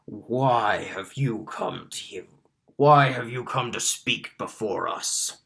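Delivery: lightly voiced Minotaur questioning those who come before him. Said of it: "the Minotaur is the first to speak".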